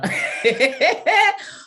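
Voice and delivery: big high cackle at her own joke; loud burst of laughter. Real, not acted. Host laughing at her own boast, loud cackle. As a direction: throw back a loud delighted laugh.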